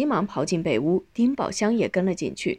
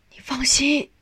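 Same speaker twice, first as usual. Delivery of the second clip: high, heartfelt reassurance; emotional promise; 6 semitones higher than usual. A narrator's reading. Son promising his dying mother, emotional. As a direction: promise with a lump in the throat.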